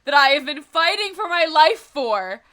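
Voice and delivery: slow, loud, high frustrated wail; exasperated outburst. Real, not acted. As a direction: stretch each word out in loud despair. Graduation clashes with TwitchCon.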